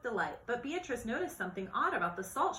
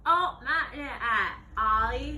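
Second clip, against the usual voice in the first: character voice; 4 semitones higher, 7 dB louder, slower than usual. Acted. Beatrice answers a friend.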